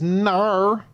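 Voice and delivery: "Nar." said high and drawn out, a Scandinavian-sounding no; teasing imitation of a foreign "Nar" for no; Nordic "nar" for no. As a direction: mimic a Swedish girl's "Nar". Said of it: "N A R is how they say N O".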